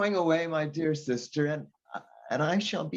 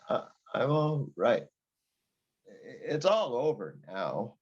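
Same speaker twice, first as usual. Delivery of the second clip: usual speaking voice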